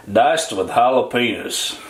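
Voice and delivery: slow, drawn-out Texas twang; Texas accent. Pretend. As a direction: read the label like a Texan. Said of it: "gotta say this in the daggone Texas accent".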